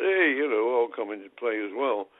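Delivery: loud mock shout from the doorway. Altafini barging in on the Pele talks, loud mock call. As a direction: call out high and loud, butting in.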